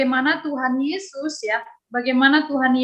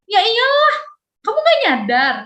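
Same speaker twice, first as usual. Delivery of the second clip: much higher, louder mock snap; hammed-up blaming outburst; mock quarrel; 10 semitones higher, 4 dB louder than usual. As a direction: pick a fight with a friend. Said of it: "Berantem lah ya".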